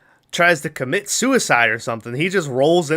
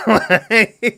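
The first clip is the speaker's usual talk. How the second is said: high burst of laughter; laughing; 8 semitones higher, 3 dB louder than usual. Real, not acted. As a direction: crack up at the absurdity. Laughing at absurd scene.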